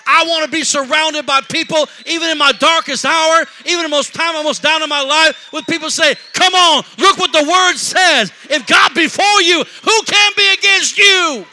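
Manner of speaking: loud, high preaching cry; full-force preaching. Acted. Preacher rallying the congregation, loud and high. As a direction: preach it out to the whole church.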